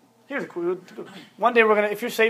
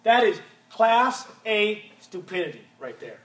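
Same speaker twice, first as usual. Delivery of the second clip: ordinary delivery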